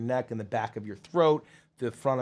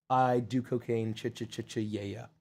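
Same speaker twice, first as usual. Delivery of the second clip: Doctor Roxo; 4 semitones lower, 6 dB quieter than usual. Acted. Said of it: "I'm not even gonna try to do Doctor Roxo".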